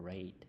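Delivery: "read" said like the colour red; past-tense read. Shown as a demonstration. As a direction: say "read" to rhyme with "bed". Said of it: "ออกเสียงเดียวกัน เดียวกันกับสีแดง".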